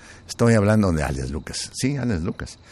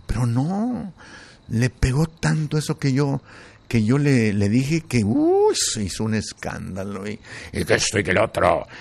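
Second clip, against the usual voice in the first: ordinary talk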